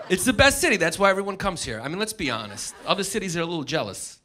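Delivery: ordinary talk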